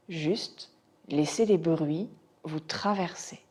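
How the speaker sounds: unhurried body-scan guidance with long pauses; guided meditation. Acted. Teacher guiding students' attention to floor and sounds, meditation voice. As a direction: guide their attention gently from point to point.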